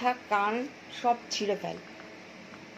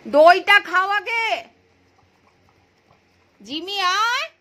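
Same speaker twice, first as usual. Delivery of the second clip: much higher, louder slow coax; calling out; 9 semitones higher, 8 dB louder, slower than usual. Real, not acted. Owner loudly coaxes Jimmy to eat the yogurt. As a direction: urge the dog to eat first.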